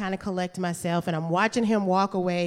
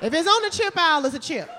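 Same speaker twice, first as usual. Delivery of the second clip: know-it-all; 8 semitones higher than usual. Retold or imitated. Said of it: "I said".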